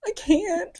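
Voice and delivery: high, worn-out give-up; exasperated. Retold or imitated. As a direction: throw up your hands in despair. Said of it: "and I'm just like".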